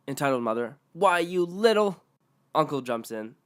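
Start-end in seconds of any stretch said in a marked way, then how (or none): 0.9-2.0 high, slow menacing sputter; sputtering mom. Acted. Entitled mother sputtering at narrator, high and slow. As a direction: sputter with rage like a snob mom.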